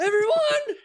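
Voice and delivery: high cracking yell, voice breaking. Retold or imitated. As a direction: shout it as your voice cracks upward. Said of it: "security guard whose voice cracked".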